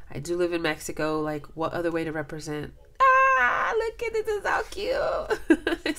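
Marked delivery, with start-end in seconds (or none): 3.0-4.2 very high, loud delighted cry; excited squeal. Real, not acted. Sees the sombrero design. 4.3-6.0 laughing. Real, not acted. Admiring the gift.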